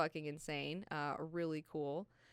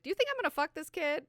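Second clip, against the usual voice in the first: scoffing; 11 semitones higher, 7 dB louder, faster than usual. Retold or imitated. Jessica scoffing at guards.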